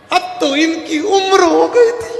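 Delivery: high, slow, loud mock sobbing; crying wife. Wife backs out of dying for husband, mock sobbing. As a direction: whine it through fake tears. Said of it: "पत्नी रोते रोते बोली".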